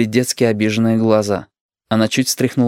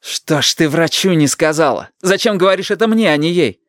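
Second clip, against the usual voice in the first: exasperated reproach; 7 semitones higher than usual. A narrator's reading. Grandson argues with grandmother.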